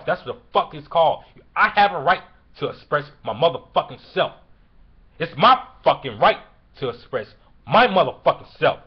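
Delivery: real furious shouting, hard emphasis on the swearing; angry. Real, not acted. Defending the right to express oneself, angry. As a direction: shout it in outrage, defending yourself. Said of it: "So why I'm so angry?".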